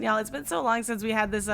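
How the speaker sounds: ordinary talk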